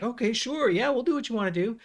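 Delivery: high, sing-song fake compliance; mock agreeable. Privately doubting sparring partner placates his rival, mock agreeable. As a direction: humour him without believing a word.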